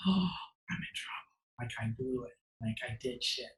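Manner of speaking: hushed, scared inner voice; panicked whisper. Retold or imitated. Boy called to the office, whispering in dread. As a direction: whisper it like you're in trouble.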